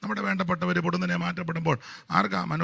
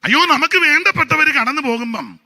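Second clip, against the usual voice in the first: loud, high, fervent sermon delivery; full-force preaching; 10 semitones higher, 11 dB louder than usual. Acted. Preacher thundering to the congregation with fervent loud preaching. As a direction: preach it out to the whole church.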